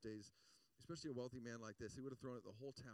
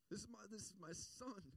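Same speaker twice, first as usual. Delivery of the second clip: overjoyed announcement, higher than usual; proud, joyful father; 8 semitones higher than usual. Acted. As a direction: pitch lifted high, swelling on "my boy". Preacher voices the overjoyed father.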